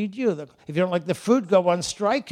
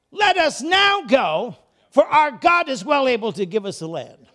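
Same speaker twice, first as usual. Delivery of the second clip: rally the people forward; rallying call; 5 semitones higher, 8 dB louder than usual. Acted. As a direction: bold charge to take the land. Joshua and Caleb call to go.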